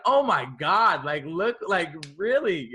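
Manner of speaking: high, drawn-out mock amazement; retold disbelief. Guest reliving a scroll through old posts, incredulous.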